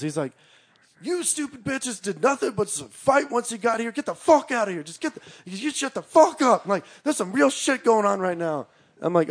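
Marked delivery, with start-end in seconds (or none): 1.0-8.7 mock screaming. Cousin screaming at guys back from the woods, mock screaming. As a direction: rant high and angry, as if screaming. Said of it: "my cousin is screaming at him".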